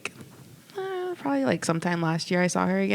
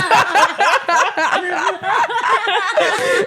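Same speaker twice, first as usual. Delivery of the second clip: long rising laugh, loud and high; high loud laughter; 8 dB louder than usual. Real, not acted. Teller cracking up.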